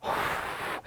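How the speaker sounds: long breathy blow; blowing sound. Acted. Teacher acts out the wolf blowing the house. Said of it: "What did he do?".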